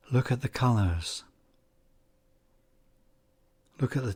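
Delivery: unhurried meditation guide, long pauses between phrases; calm, measured guiding voice, phrase endings gently falling away; guided meditation. Acted. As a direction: ease the listener into calm focus, leaving space after each phrase.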